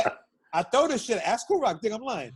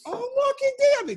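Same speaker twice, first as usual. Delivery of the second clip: mock swooning women; slower than usual. Women reacting to pickup line.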